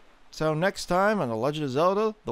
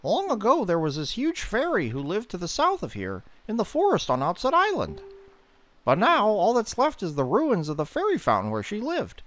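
high, chatty fish-man, quick and lively; a cheeky fish trading tips for bait; Fishman voice; 6 semitones higher than usual. Acted. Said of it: "this is our last Fishman".

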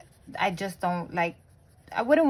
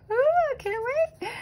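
excited squeal. Real, not acted. Excited about counting savings.

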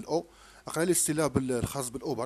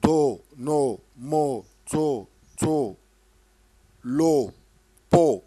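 syllables called out louder, one by one; loud drilled syllables; 9 dB louder, much slower than usual. Shown as a demonstration. Teacher leading a syllable drill, loud. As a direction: hammer each syllable for the child to echo.

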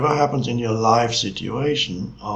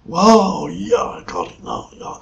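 mock excitement; 8 semitones higher, 8 dB louder than usual. Teacher mimicking someone who got it, mock excitement. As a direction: act out a gushing burst of realisation.

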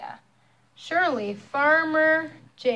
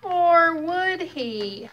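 slow, higher ominous question; suspenseful; 6 semitones higher, 4 dB louder, much slower than usual. A narrator's reading. Storyteller teasing the child, slow and ominous. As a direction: stretch it out to build dread.

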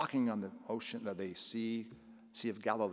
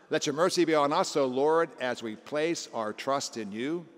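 much louder, higher chant-like recitation; psalm response; 4 semitones higher, 8 dB louder than usual. Acted. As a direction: proclaim the response to the congregation. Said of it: "What was the psalm response".

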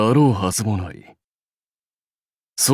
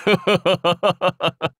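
high rolling chuckle at his own joke; amused warlord laugh; self-pleased laugh; 9 semitones higher than usual. Acted. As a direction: laugh before delivering your pun.

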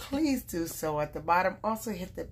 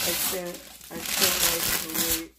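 usual speaking voice

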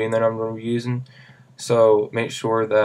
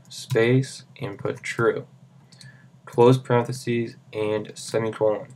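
dictating. Shown as a demonstration. Reading code out to type.

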